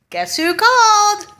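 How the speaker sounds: spring it on them like a punchline; sarcastic reveal. Real, not acted. Mom telling viewers the school called, slow and sarcastic.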